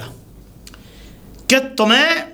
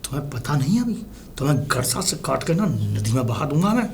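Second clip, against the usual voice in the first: very low-pitched, softer threat; low menace; 11 semitones lower, 6 dB quieter than usual. Acted. Husband threatening his wife Nandini, low and menacing. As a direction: drop deep and quiet to threaten her.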